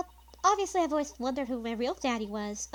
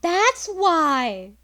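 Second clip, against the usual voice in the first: sudden realization; 8 semitones higher, 15 dB louder than usual. Acted.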